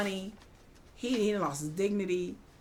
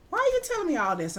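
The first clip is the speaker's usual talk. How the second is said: high, fast suspicious question, acted; puzzled wife; 10 semitones higher, faster than usual. Wife questioning Mister Morris, acted high-pitched puzzlement. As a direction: imitate a wife sensing something is off.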